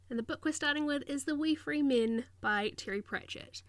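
usual speaking voice

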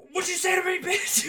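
high, loud mock macho challenge; angry Apollo. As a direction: bark it like a cocky boxer squaring up. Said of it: "Apollo just being like".

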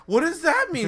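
high, loud baffled question; confused what. Retold or imitated. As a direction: act totally lost at the slang. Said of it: "And I'm like".

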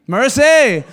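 loud comic exclamation; exclaimed mercy. Real, not acted. Preacher to church, joking, loud exclamation. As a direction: shout it as the punchline.

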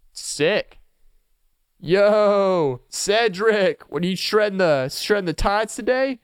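surfer dude. Pretend. Pretend surfer dialogue.

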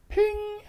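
high, ringing "ping"; imitated alert ping. Driver mimicking his ride alert, a high ping.

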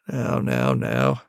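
very low dad voice; dad gently chiding. Acted. Dad putting off his child's question, gently chiding. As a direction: drop the pitch deep and hold the questioner back.

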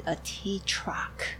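hushed, breathy reveal; whispered secret. Real, not acted. Secret news not out yet.

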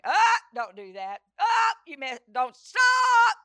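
high, loud panicked yell at the new driver; mimicked mother hollering at the wheel; mom screaming. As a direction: shriek like a nervous mother. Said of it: "her screaming and hollering".

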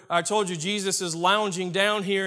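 ordinary talk

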